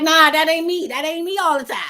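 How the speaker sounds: bursting out as her hyper self; lively real self. Pretend. [name] shows her real animated self to viewers, loud and high. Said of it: "I'll be like".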